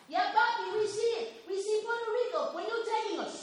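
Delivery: put-on young boys' voices, pitched high; eager kids answering their father; child voices. Young boys eagerly answering their dad, high child voices.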